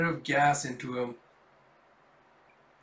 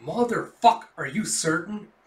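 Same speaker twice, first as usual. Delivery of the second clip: loud, higher sudden curse; angry outburst; 4 semitones higher, 6 dB louder than usual. Acted. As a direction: explode at the bad news. Commander hearing telemetry is ghosted.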